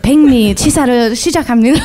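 fast machine-like announcement in Korean; rice cooker voice. Retold or imitated. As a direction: rattle it off like an appliance. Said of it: "What does it say?".

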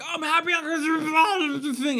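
mock giddy self. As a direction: play an overeager happy fool. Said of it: "I don't wanna be like".

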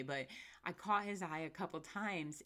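ordinary talk

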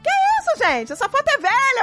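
high, panicky mock squeal; flustered mock excuse. Mother imitating her own panicked excuse to her son, high. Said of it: "Eu falei".

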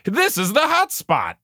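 high-pitched retold yell, not very loud; hammed-up high holler; mock scream. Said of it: "Conway out loud screams".

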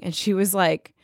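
ordinary delivery

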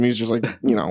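ordinary talk

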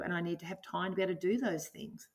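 ordinary delivery

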